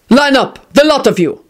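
high, forceful command; demanding. A narrator's reading. Gang leader to the family, demanding. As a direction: demand it in short bursts. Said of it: "he demanded".

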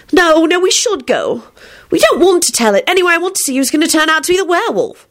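put-on high-pitched, insistent young woman; insistent girl. Rosie insisting to William that they should go, high and insistent.

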